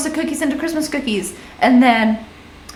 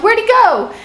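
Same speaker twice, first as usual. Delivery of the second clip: sudden high puzzled "where'd he go"; surprised question; 11 semitones higher, 4 dB louder than usual. Retold or imitated. Friends realizing their buddy is gone, asking surprised. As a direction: voice the friends looking around, confused.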